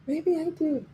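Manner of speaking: high, indignant dog voice; voicing the dog. Owner voicing her dog's huffy reply, high-pitched. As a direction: pipe up like an offended little dog. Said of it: "She's like".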